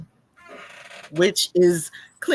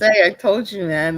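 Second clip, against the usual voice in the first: ordinary talk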